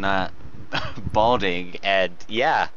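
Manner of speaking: ordinary talk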